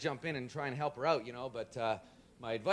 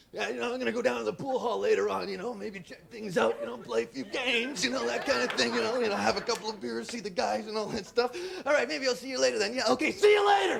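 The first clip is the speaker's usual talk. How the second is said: higher, looking away, faster at the end; lightly voiced tough guy; 5 semitones higher than usual. The tough friend talking, a lightly voiced imitation. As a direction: brag about the pool hall. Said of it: "it's sort of like".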